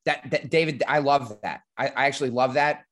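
ordinary talk

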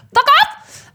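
sudden high squawked outburst; chicken-like squawk. Real, not acted. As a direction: burst out high, like a startled hen. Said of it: "I sound like a chicken".

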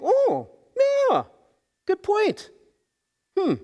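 high, mock-impressed crowd voice; convinced townspeople. Townspeople conceding to Gideon's father, in a high mock-impressed voice. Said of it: "and people are like".

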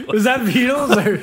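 ordinary talk, nothing marked

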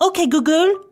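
high-pitched caricature of a French speaker; imitation French accent. Shown as a demonstration. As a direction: put on a patronising cartoon French accent. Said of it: "patronising imitation French mode".

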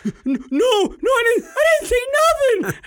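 put-on high, loud little-kid whine; whiny kid denial. The son denying to his dad in a high, whiny kid voice. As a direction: plead innocence like a panicked child.

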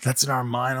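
a hint of apologetic casting voice; mock casting rejection. Voicing casting people's polite rejection.

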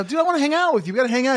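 very high put-on dude voice; imitating JD's voice. Host retelling night out with crew buddy, high mimicking voice. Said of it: "That's how he talks".

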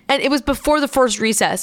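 normal talking voice

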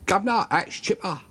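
put-on a quick, lightly done Cockney accent; playful imitation of a Cockney accent; mock Cockney. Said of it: "if you're a Cockney, you talk like that and you say".

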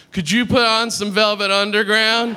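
lightly acted patient on morphine; morphine-addled request. Drugged request to his doctor.

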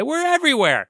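high-pitched singsong excitement; gleeful boast. Pretend. Host bragging the show is on every platform, gleeful high boast.

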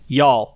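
"Y'all" as a crisp American model; American pronunciation of Y'all. Shown as a demonstration. Teacher modelling "Y'all" to repeat, American pronunciation. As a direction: say "Y'all" as one American syllable, falling. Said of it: "lesson in non-regional Western dialect".

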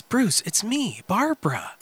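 soft, slow, intimate; hushed reveal. Retold or imitated. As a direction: breathe it out slowly and softly. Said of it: "She goes".

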